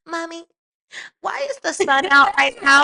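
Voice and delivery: put-on high, loud, slowed little kid asking; child voice. Talkative son quizzing mom in the morning, high kid voice. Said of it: "in the morning he'd be".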